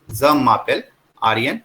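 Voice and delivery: ordinary talk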